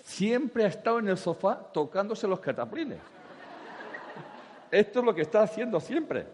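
high, loud, laughing mock outrage; laughing rant. Speaker tells the lady her husband never changed, laughing loudly. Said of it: "Y dice".

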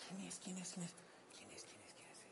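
softly whispered, over and over; whispered repeats. Retold or imitated. Caller on the phone, whispering quién es while trying to identify. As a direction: whisper it, straining to place the voice. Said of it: "estás intentando identificar".

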